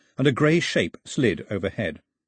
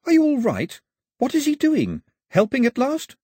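put-on high, bright, carefree kid; cheery boy voice; 13 semitones higher, 3 dB louder than usual. A narrator's reading. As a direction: chirp it out, clueless and cheery. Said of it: "with the cheeriness of someone who doesn't know".

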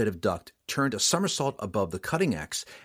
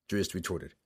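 usual speaking voice

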